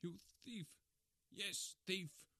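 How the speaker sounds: high-pitched enraged accusation; angry roar. A narrator's reading. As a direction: roar the insult, pitch thrown up. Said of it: "I roared".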